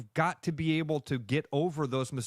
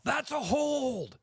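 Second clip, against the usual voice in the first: high, drawn-out mock holler; mock fan yell; 11 semitones higher, slower than usual. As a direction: imitate a fan yelling at the game. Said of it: "you hear somebody yell".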